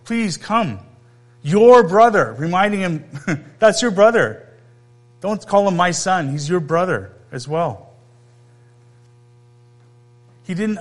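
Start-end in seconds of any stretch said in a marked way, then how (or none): 0.0-0.8 softer, tender please; soft pleading. Acted. Father softly begging his older son to come in. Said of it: "he's pleading with him". 3.6-7.9 firm reminder. Acted. Father correcting the older son.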